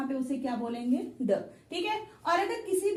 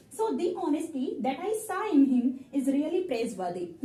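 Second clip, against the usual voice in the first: slow, careful read with "the" as long "दी"; slow model sentence; much slower than usual. Shown as a demonstration. Teacher reads the example sentence slowly to the class, careful. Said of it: "दी".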